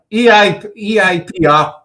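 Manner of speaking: usual speaking voice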